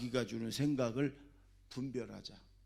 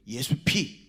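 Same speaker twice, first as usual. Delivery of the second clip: loud exclamation; sharp cry; 8 dB louder, slower than usual. Retold or imitated. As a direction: snap it out like a rebuke. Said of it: "그런다네".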